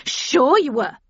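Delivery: spat out. A narrator's reading. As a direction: spit it back in disbelief. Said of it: "she spat".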